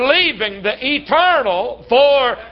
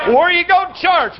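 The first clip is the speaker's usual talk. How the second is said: preacher's challenge; 6 semitones higher than usual. Retold or imitated. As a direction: call it out to pin him down. Preacher questions a churchgoer.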